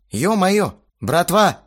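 high, loud exasperation; exasperated appeal. A narrator's reading. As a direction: call the band to order. Narrator reprimands the quarrelling band. (с досадой, громко)

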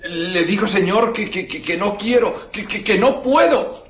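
high, stammering, panicked voice; frightened stammer. Acted. The vicar, held captive, stammers to the innkeeper through the door, high and panicked. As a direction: stutter out the forced lie in fear.

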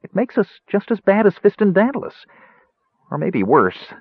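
high, halting, upset Kender; quivering voice. A narrator's reading. Tass confesses his doubts to Caramon, quivering voice. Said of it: "continued in a quivering voice".

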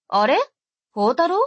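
put-on a lively older sister picking up; sister's voice. Sister on the phone.

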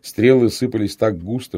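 ordinary delivery

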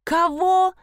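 high-pitched startled squeal. A narrator's reading. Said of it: "завизжала Нина". (высокий визгливый вскрик)

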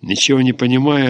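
plain reading voice

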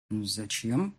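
low, uneasy question from the father; tense question. A narrator's reading. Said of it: "напряжённо спросил он". (напряжённо)

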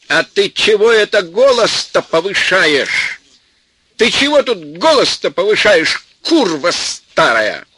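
enraged shouting at the mother-in-law; furious. Acted. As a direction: swoop down in rage, slowing on the insult. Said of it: "взмыл над землёй от ярости".

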